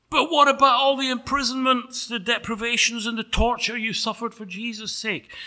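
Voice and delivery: very high, urgent objection; protesting angel. Acted.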